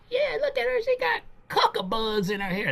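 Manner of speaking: high mocking gossip voice; gossiping woman. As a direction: sneer at her like a catty tablemate. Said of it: "was saying".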